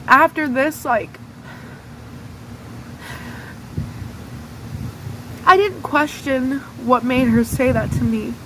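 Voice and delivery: real upset while retelling, pitch lifted and tight; voice breaking. Real, not acted. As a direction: push through the words as the memory hurts. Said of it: "It's really hard for me to talk about".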